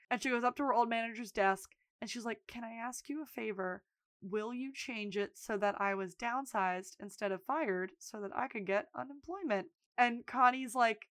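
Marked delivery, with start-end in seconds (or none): 2.5-9.7 hushed, tentative request; soft plea. Retold or imitated. Fired worker asking old manager a favour, soft plea. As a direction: ask gently, much softer.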